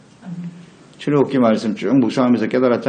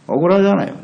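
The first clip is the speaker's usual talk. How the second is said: normal talking voice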